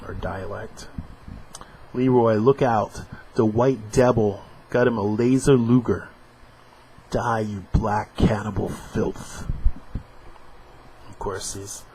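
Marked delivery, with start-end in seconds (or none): 1.9-9.5 comic dialogue read with voices; comic strip read aloud; dramatic reading. Acted. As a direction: act out the panel, a little louder.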